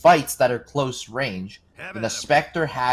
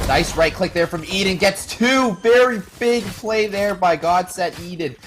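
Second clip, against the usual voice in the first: excited; 8 semitones higher, 4 dB louder than usual. Real, not acted. Caster hypes a big play.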